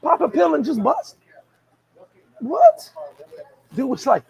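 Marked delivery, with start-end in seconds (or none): 0.0-1.2 high, incredulous "what?"; mock disbelief, voice up high. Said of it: "I'm like". 2.4-2.9 high, loud, rising "What?"; shocked what. Real, not acted. Reacting in disbelief.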